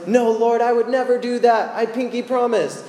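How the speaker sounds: high, loud, hammed-up promise; mock insistent denial; over-earnest vow. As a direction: swear it like an overeager kid. Acts Peter vowing loyalty to Jesus.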